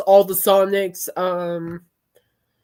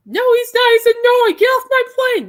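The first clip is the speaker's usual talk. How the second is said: put-on much higher, loud shrill screech of a twelve-year-old; screaming kid; 12 semitones higher, 4 dB louder than usual. Imitating the twelve-year-old screaming in the Facebook reel, high and loud. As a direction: shriek it like an angry kid. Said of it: "The twelve-year-old just started screaming".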